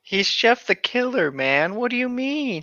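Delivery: normal talking voice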